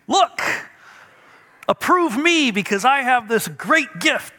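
put-on high, eager child's voice; a small kid waving his present for approval; excited boy pleading for praise; little boy showing off. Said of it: "when I was a little boy and I got a gift, I'd say".

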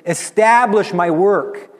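loud, slow, high and impassioned; passionate. Acted. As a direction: proclaim it with passion. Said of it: "He says it with passion".

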